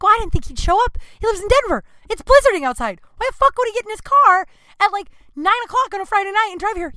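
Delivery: high and loud indignant rant; high, loud self-justifying outburst to Adam; defensive protest. Retold or imitated. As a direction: protest it all in one rising burst. Defending herself in the quarrel.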